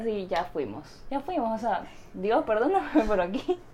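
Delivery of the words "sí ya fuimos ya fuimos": slow, soft, resigned "ya fuimos"; a soft slow sigh of defeat; resigned mutter. Retold or imitated. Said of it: "ahí ya dije".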